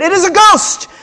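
high, loud frightened cry; terrified cry. A narrator's reading. Disciples crying out in fear on the boat, high and loud. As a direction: cry it out in alarm at a ghost. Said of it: "they were terrified and said".

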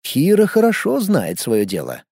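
usual speaking voice

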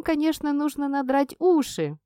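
ordinary delivery

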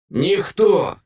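high, loud creature cry; monster's high, forceful shout; distorted monster yell. A narrator's reading. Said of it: "Монстр закричав спотвореним звуком".

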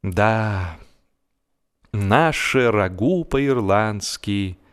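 ordinary delivery, nothing marked

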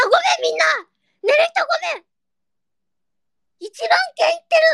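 high, excited shouts, apologizing to sleepers; shouting in delight at the tweet count. Real, not acted. Shocked at ten thousand tweets.

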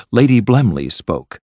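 ordinary delivery

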